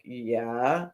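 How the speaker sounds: low, soft, drawn-out questioning yeah; retold tentative yes, voice lifting at the end; hesitant agreement. Builder recounting her answer about the Today show, hesitant.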